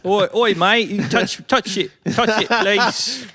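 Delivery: high, hammed-up Cockney voice; British accent. Mock British accent teasing friends about porn. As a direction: camp it up as a cheeky Londoner. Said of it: "That's good, ain't it?".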